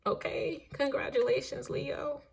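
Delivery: high, slow, teasing congratulations; excited praise. Real, not acted. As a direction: hype them up playfully. Exaggerated praise, laughing high voice.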